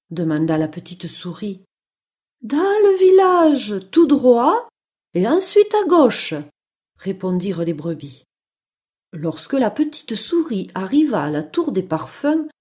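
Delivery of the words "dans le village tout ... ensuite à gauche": high storybook sheep voice; sheep giving directions. Acted. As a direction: point the way as a sheep. Sheep give mouse directions. (voix aiguë de brebis serviable)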